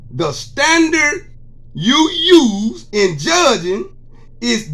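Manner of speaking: slow, deliberate, each word weighed; slow scripture reading. Acted. Man reading Matthew to his viewers, slow preaching cadence. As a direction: read the verse like a preacher laying down law.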